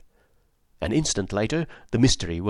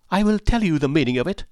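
higher, fast defiant declaration; proud declaration; 6 semitones higher, faster than usual. A narrator's reading. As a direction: announce it proudly, pushing on. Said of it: "cried the lady".

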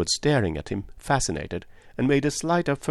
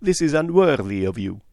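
firm, higher and louder; grave reproach; 6 semitones higher, 5 dB louder than usual. A narrator's reading. Detective rebukes a wavering woman, gravely. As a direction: shame them gravely. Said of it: "said Poirot gravely".

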